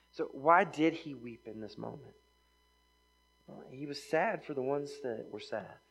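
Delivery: ordinary talk, nothing marked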